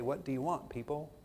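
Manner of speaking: lightly imitated Southern accent, low and soft. Teacher leaning toward his class with coffee, light Southern accent. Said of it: "in his lovely Southern accent".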